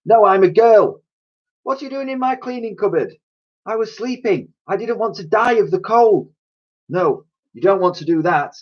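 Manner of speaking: a small cockney orphan, wary; street girl voice. Acted. Elsie voiced in story.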